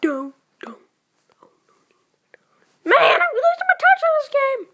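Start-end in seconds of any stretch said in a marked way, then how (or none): 2.8-4.6 high, loud whiny cry; frustrated wail. Real, not acted. Gamer wails about losing his touch, frustrated. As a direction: wail it out like the game is unfair.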